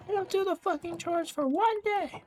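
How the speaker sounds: very high, shrieky imitation without the volume; high-pitched retold tirade; overreacting mom. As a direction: mimic an upset mother's outburst. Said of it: "she's overreacting".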